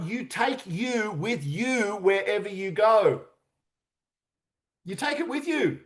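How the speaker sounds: normal talking voice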